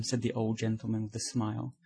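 usual speaking voice

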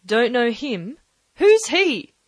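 high, loud scornful question; contemptuous cry. Acted. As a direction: scoff loudly at the stranger. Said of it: "cried the captain".

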